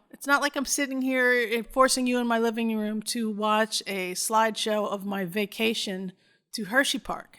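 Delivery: usual speaking voice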